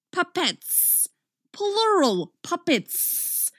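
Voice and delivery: high, drawn-out appalled emphasis. A narrator's reading. Sophie when the other puppets came in, appalled. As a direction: dwell on each word in horror. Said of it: "plural is going to be her appalled answer".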